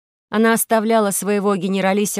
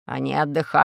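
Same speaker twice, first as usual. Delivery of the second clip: put-on low, creaky old commander; 5 semitones lower than usual. A narrator's reading. Generalissimo says the captives are resting, creaky and low. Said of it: "сказал скрипучим голосом генералиссимус".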